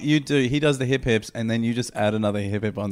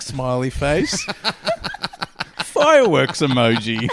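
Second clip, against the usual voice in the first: laughing; 5 semitones higher, 5 dB louder, slower than usual. Real, not acted. Laughing reading.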